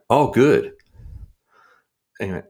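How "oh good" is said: mock delight. Mock delight at scam call.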